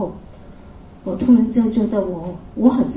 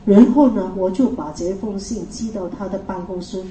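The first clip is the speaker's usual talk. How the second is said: ordinary delivery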